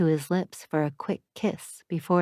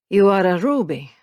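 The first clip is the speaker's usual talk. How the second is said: put-on louder, Italian-accented man's question; 6 dB louder than usual. Matteo asks the shop owner, Italian accent. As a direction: ask it with an Italian lilt. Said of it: "an Italian accent bending his words".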